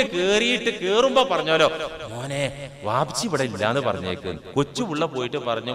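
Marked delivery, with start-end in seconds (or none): 2.1-4.4 low, soft, quick undertone; hushed instruction. Acted. Father in hen coop telling child to lie, hushed. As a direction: keep it down like someone hiding.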